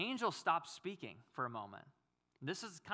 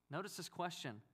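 normal talking voice

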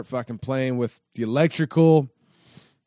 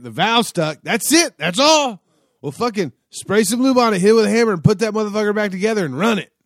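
much higher, louder, brisk no-big-deal instructions; voicing Billy; 8 semitones higher, 7 dB louder than usual. Billy the builder telling the rider to just hammer the valve, voiced much higher, louder and breezy. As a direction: rattle it off like it's nothing.

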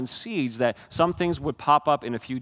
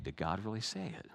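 hushed echo; 7 semitones lower, 13 dB quieter than usual. Real, not acted. Repeating Satan's line softly.

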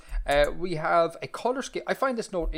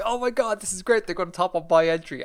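higher-pitched imagined fan; mock excitement; 5 semitones higher than usual. Host imitates a listener hoping for their entry, mock excited. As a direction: gush like a delighted listener.

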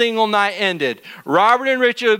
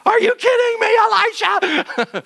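panicked disbelief; 14 semitones higher than usual. Acted. Servant disbelieves Elisha's reassurance.

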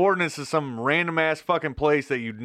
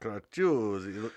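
mock Italian; 2 semitones lower, 6 dB quieter, much slower than usual. Shown as a demonstration. Host jokingly Italianizing the brand name for his cohost, mock Italian accent. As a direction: say "Cracciuzzi" slowly in a hammed-up Italian accent, "kra-CHOO-tsee". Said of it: "It sounds very, uh, Italian".

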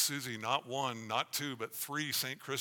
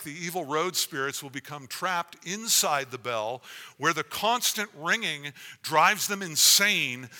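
spooky ghost tour hush; 3 semitones higher, 10 dB louder than usual. Acted. Reads bell legend aloud dramatically.

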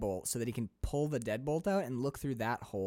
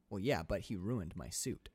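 quieter, slow deadpan villain; deadpan villain reply; 6 semitones lower, 8 dB quieter than usual. Retold or imitated. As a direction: shrug it off, slow and soft. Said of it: "he's like".

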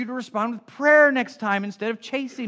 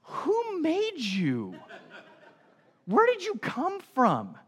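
laughing disbelief; 8 semitones higher, slower than usual. Retold or imitated. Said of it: "I'm like".

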